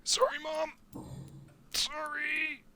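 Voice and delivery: sheepish falsetto sorry; mock childish apology. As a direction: squeak it out like a guilty kid. Apologising to game mother.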